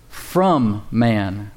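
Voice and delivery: "from" set apart after a pause, drawn out; emphatic, lengthened "from"; stress on "from". Shown as a demonstration. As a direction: lean on the word "from" and hold it. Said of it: "with the emphasis on that word from".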